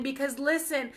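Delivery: normal talking voice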